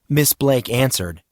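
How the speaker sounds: plain reading voice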